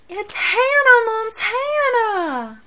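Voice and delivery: high, slow, drawn-out TV show announcer cry of "It's Hannah Montana!". Retold or imitated. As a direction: belt "Hannah" high and stretch it out, showbiz style. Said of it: "I usually like to end that with".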